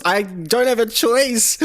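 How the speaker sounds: high, drawn-out plea of innocence; mock protest. Streamer defending a weapon choice to a scolding viewer, mock protest.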